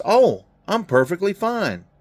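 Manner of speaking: put-on high, sweet old lady; a high voiced old lady, cheerfully fine. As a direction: chirp it like a cheerful grandma. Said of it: "She goes".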